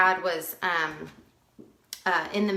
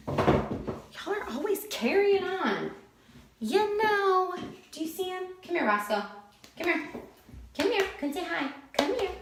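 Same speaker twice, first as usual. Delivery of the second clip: calling the dogs; 10 semitones higher, 3 dB quieter than usual. Real, not acted.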